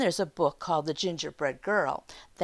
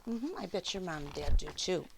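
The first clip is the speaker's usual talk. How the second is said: usual speaking voice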